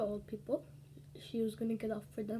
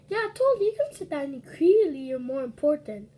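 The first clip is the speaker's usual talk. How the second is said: much higher and louder, mocking; sarcastic shout; 4 semitones higher, 10 dB louder than usual. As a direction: fake agreement dripping with sarcasm. Sarcastic reply to the lady.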